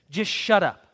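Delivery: put-on mother grabbing the boy's arm, snapping at him; jerked command. A narrator's reading. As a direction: yank him and snap it. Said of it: "With a jerk, she said".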